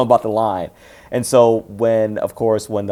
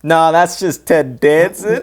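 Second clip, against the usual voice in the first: slow, raised quoted punchline; Hank; 7 semitones higher, 4 dB louder, slower than usual. Acted. Podcaster voices the film's dismissive reply, slow, amused delivery.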